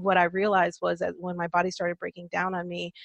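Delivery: normal talking voice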